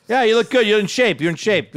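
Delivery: high, forceful manly compliments; mock yelling. Man addressing guys in steam room, mock yelled compliments. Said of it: "I yell at him in there".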